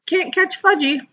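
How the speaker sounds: put-on high little boy taunt; high sing-song child taunt; toddler hollering. A narrator's reading. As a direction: tease like a small kid running off. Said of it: "he hollered".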